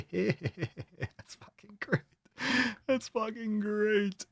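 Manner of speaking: high, drawn-out squeal through giggles; giggling delight. Real, not acted. Host cracking up at a picture, high giggly delight. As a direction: crack up, stretching the words high and soft.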